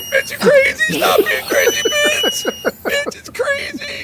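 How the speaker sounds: very high whiny dog voice; dog whining voice. Pretend. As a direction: whine it like a dog. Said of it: "the dog's whining like".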